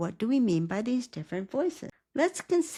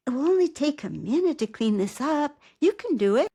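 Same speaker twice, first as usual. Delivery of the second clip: higher and louder, bright encouragement; cheerleader voice; 6 semitones higher, 4 dB louder than usual. Shown as a demonstration. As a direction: cheer your character on. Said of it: "your character's cheerleader".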